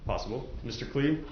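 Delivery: ordinary talk, nothing marked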